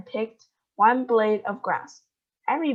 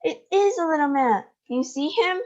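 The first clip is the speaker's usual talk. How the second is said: put-on excited boy; 7 semitones higher than usual. A narrator's reading. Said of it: "cried Charlie".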